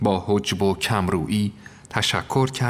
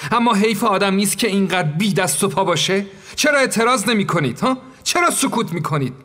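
indignant scolding; 8 semitones higher than usual. Acted. Narrator scolding the meek maid.

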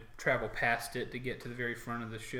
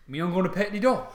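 loud, higher orc voice; orc soldier; 6 semitones higher, 8 dB louder than usual. Acted. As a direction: insist loudly as an orc guard. Orc guards squabble over petting dog.

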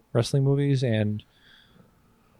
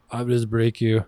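Drago quote; 3 semitones lower, 4 dB quieter, slower than usual. Retold or imitated. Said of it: "This dude".

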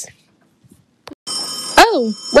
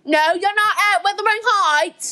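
higher, insistent Yorkshire servant; thick Yorkshire accent; 6 semitones higher than usual. Acted. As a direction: protest in broad Yorkshire. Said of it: "That is one thick Yorkshire accent".

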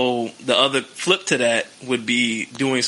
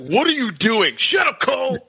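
bickering commentators; 10 semitones higher than usual. Retold or imitated. Mock commentator squabble on the broadcast table. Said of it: "Cole would be like".

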